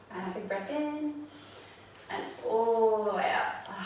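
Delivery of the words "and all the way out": drawn-out exhale cue. Shown as a demonstration.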